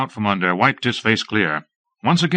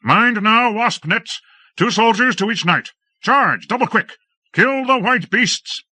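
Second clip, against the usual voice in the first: very high, strained commands; hoarse battle orders; 12 semitones higher than usual. A narrator's reading. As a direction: roar through a thick throat. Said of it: "his throat thick with fumes".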